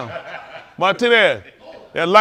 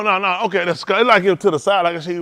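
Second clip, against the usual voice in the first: normal talking voice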